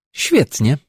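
high bright exclamation; exclaiming. A narrator's reading. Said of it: "krzyknęła Wanda".

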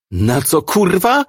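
high, rising incredulous demand; angry outburst. A narrator's reading. Officer pouncing on the suspect's choice of word, angry. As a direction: snap it, leaping up on the curse.

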